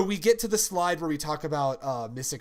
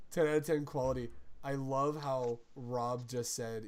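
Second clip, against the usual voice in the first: usual speaking voice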